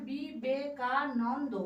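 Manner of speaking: বিবেকানন্দ said slowly, syllables apart. Shown as a demonstration. Teacher repeating how বিবেকানন্দ was said, slowly. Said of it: "কিভাবে উচ্চারণ করলাম".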